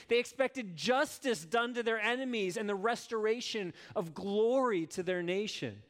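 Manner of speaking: normal talking voice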